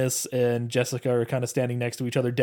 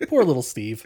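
usual speaking voice

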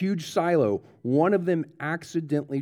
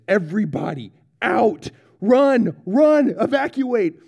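high, loud yelled order to flee; urgent shout; 6 semitones higher, 5 dB louder than usual. Retold or imitated. General ordering everyone out of the bunker, urgent shout. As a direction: bark the evacuation order.

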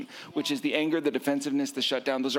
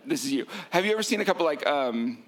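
usual speaking voice

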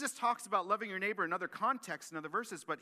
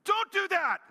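put-on high, loud mom scolding her kids; mom yelling; 10 dB louder than usual. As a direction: shriek it like a mother who's had enough. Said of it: "like a mom yelling at their children".

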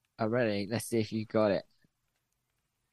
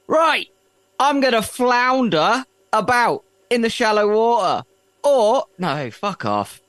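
silly fish voice; 8 semitones higher, 5 dB louder than usual. Pretend.

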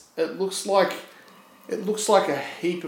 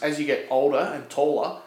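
normal talking voice

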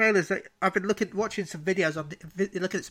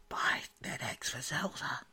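sneaky stage whisper; 6 semitones lower, 11 dB quieter than usual. Pretend. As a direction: whisper it like a secret aside. Sneaky aside about Nintendo.

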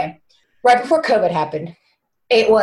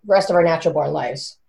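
usual speaking voice

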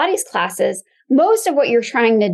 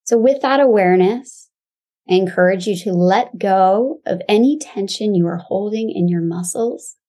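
calm grounding exercise. Acted. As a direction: guide them gently into the body.

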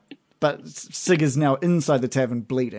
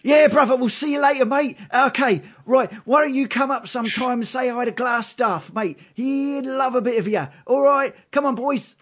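much higher, louder cocky thug voice; cheery ruffian; 10 semitones higher, 3 dB louder than usual. Acted. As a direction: play a chummy street thug calling mate. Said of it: "and he says".

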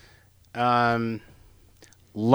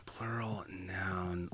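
whispering; 4 semitones lower, 20 dB quieter, slower than usual. Real, not acted. Reading out the card's blank.

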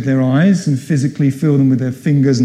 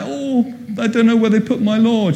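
higher, lightly plaintive quote; mock lament, pitched up without real tears; acted distressed Mary; 7 semitones higher than usual. Mary telling the supposed gardener of her Lord, mildly distressed.

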